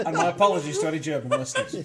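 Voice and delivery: loud, helpless laughing apology. Real, not acted. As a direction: apologise through real laughter. Laughing at bad German attempt.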